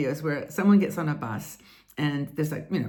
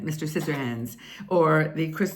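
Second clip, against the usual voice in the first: usual speaking voice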